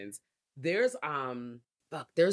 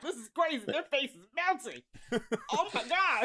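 high, excited mock disbelief; mock shock; 10 semitones higher than usual. Friend retelling a gory movie scene, high squealing disbelief. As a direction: squeal it like you can't believe it.

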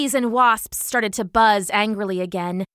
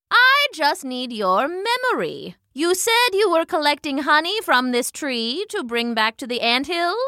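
lightly voiced, higher measured questioning; patient old hornet; 7 semitones higher than usual. A narrator's reading. Old hornet questioning the ant witness, patient. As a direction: coax a witness along patiently.

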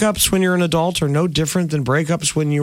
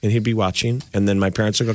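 usual speaking voice

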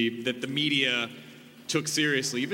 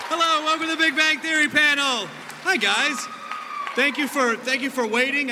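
excited greeting; 8 semitones higher, 5 dB louder than usual. Real, not acted. Host opens packed panel.

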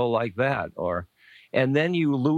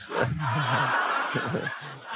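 a soft chuckle breaking into the story; real laugh; 3 semitones higher, 3 dB quieter than usual. Real, not acted. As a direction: laugh quietly at the memory. Laughs mid-story about Ram Dass.

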